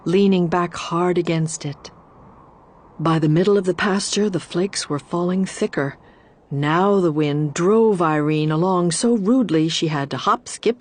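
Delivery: plain reading voice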